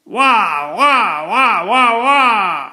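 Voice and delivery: put-on loud high baby wail; baby yelling. A narrator's reading. Baby yelling at the enormous needle. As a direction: shriek like a terrified infant. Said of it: "it yelled as loud as it could".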